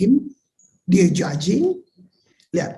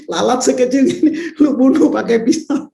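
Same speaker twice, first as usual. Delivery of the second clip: laughing through the words, louder and higher; 5 semitones higher, 4 dB louder than usual. Real, not acted. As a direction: say it while cracking up. (sambil tertawa keras)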